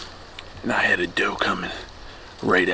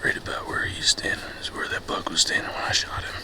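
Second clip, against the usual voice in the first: whispering; 8 semitones higher, 10 dB quieter than usual. Real, not acted. Whispering as second buck approaches.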